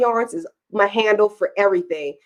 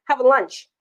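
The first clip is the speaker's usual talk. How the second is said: normal talking voice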